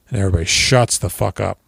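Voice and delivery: ordinary talk, nothing marked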